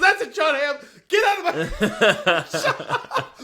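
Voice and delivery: high mock outrage breaking into laughter; play-acted banishment, dragged out through laughs; laughing mock shout. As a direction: imitate throwing a customer out while cracking up. Retold owner throwing customer out, laughing.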